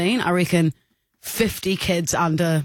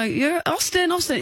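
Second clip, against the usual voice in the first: put-on high-pitched call to a child; 10 semitones higher than usual. Aunt trying to get a dizzy child's attention, calling out. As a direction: imitate calling a toddler's name, pitched up.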